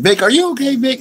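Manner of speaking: high, mock-concerned question to himself; mock worried voice. Host teasing listeners with a mock worried check-in. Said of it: "I just did that to see".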